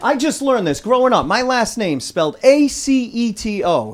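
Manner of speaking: ordinary delivery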